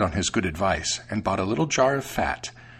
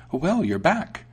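high-pitched mouse welcome; welcoming mouse; 8 semitones higher, 3 dB louder, slower than usual. A narrator's reading. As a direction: greet the cat in a high mouse voice. Mouse greets returning cat.

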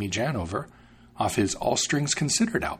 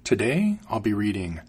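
ordinary delivery

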